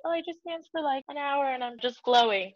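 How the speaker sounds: high, soft mock girly voice; glowing girls. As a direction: mimic those effortless girls, high and soft. Mimicking girls who glow after dancing.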